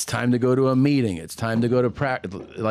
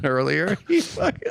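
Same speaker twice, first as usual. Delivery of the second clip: laughing; 3 semitones higher than usual. Real, not acted. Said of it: "I had to turn away from him".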